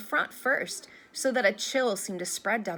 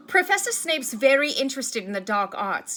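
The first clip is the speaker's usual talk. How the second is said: louder, higher sudden blurt; 4 semitones higher, 7 dB louder than usual. A narrator's reading. Harry suddenly warning Professor Lupin about Snape, blurted out. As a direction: let it burst out before thinking. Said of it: "he blurted out".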